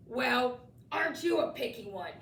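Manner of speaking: put-on haughty, sniffing girl monster; sniffy. A narrator's reading. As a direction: sniff at him, disdainful. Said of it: "she sniffed".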